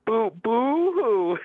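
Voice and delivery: slow, high mock boo-hoo; fake-sob it to taunt; mock crying. Caller mocks the host as a crybaby. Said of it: "here's an example of what you sound like".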